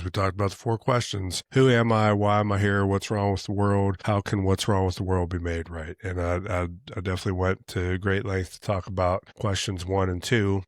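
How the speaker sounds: ordinary talk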